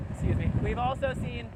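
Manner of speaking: normal talking voice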